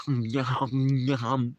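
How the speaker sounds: imitating a stoned friend munching; hammed-up yum yum of a tripping friend; mock yum. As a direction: smack it out like chewing on plastic.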